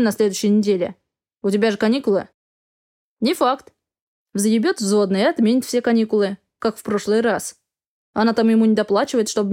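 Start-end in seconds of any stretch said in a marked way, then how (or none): none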